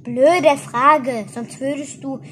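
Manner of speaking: irritated, loud at the start; annoyed growl. A narrator's reading. Ette answers Toni's question, mildly annoyed. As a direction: snap back with mild annoyance. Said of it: "knurrte Eze verärgert".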